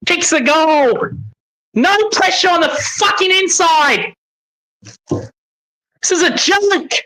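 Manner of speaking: high, dragged-out outraged rant; outraged disbelief. Real, not acted. Fan watching his team concede, high outraged rant. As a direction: let the disbelief climb and stretch.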